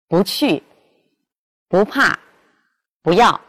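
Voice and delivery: 不 said with a rising second tone before the falling syllable; rising 不, then a falling second syllable; bu rising before fourth tone. Shown as a demonstration. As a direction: let 不 rise in 不去 and 不怕. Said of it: "在去声前变阳平调".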